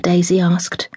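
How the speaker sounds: plain reading voice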